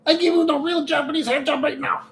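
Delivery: high mock grandma with broken English. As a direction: squeak it like a pushy grandma. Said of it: "she's like".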